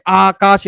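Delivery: Akash split into আ and কাশ; broken into syllables. Shown as a demonstration. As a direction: say Akash as two measured beats, long আ first. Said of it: "এভাবে করে ভাঙতে হবে".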